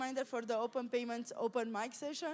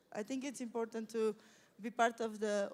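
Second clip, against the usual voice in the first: ordinary delivery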